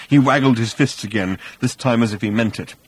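ordinary delivery

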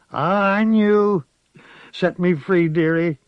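put-on high, slow old woman's voice, relieved; old witch. A narrator's reading. Dying witch asks the child to set her free, high old voice. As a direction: sigh out a plea for release.

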